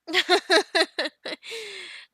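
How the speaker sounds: loud burst of giggles; bright peal of laughter; amused laugh. Acted. Amused at the Viking's question.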